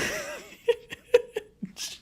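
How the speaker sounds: high, real wheezing laughter; helpless laughing fit. Real, not acted. Podcast host losing it mid-recap, laughing helplessly. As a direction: burst out laughing mid-sentence and lose it.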